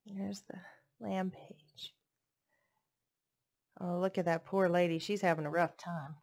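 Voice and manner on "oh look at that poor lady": usual speaking voice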